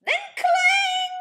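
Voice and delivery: high, loud ringing shout; loud clang. A narrator's reading. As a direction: belt it like a clanging gate. Drawbridge sound in story.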